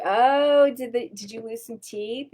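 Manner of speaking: put-on sing-song kid voice; talking to a child. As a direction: coo at a little girl. Talking sweetly to little girl.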